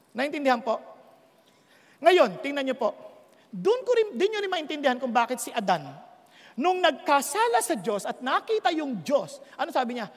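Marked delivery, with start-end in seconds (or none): none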